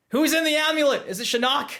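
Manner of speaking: high-pitched, excited fan questions; excited speculation, voice jumping up; overexcited. Real, not acted. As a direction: get carried away with fan excitement. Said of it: "I don't think I should have got that excited".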